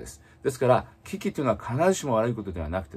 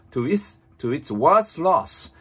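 slow, raised-pitch English model phrase; read-aloud model; 5 semitones higher, 4 dB louder, much slower than usual. Shown as a demonstration. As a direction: articulate it slowly and fully for learners. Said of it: "本当に大きな声で唇を思いっきり動かして". (ゆっくりはっきりしたお手本の音読)

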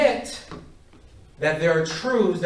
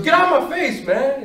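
high, loud angry retort; acted-out mad kid; real mad; 9 semitones higher, 7 dB louder than usual. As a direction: lash back angrily, loud and high. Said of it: "He gets real mad".

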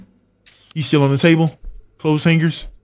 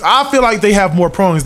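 normal talking voice

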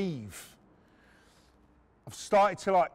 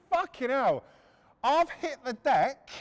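ordinary talk

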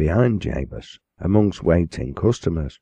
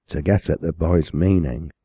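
ordinary delivery

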